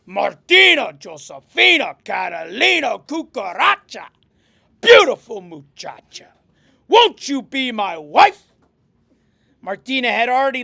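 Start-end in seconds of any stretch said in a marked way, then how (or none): 0.0-8.5 loud, booming courtship serenade; pompous suitor. Acted. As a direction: declaim the proposal with punched opening words. Pig suitor's booming proposal.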